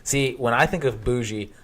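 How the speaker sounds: ordinary talk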